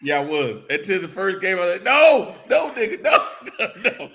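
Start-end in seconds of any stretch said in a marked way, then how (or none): 1.8-4.1 laughing, high, repeated no. Retold or imitated. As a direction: laugh through the noes. Laughing mock denial.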